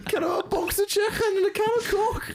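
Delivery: hammed-up Scouse whine, near crying; shrill mock Scouse wail. Podcaster redoes the Scouse impression as a comic wail. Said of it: "it's like a".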